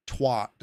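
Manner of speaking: "twat" drawn out the American way, with an open "ah"; slow, mocking American "twat"; American pronunciation. Streamer puzzling over the American word, "twat" said American-style. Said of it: "Where's the R coming from in that one?".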